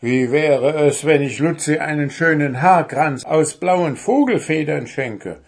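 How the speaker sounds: high, loud threatening gnome; irate gnome's mocking threat; scolding, nagging. A narrator's reading. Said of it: "keifte Puh".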